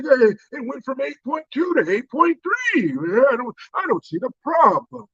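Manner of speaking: high, breezy put-on old-man voice; mock old politician. Comedian mocking the president shrugging off inflation, high and breezy. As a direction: shrug off the numbers like a clueless leader.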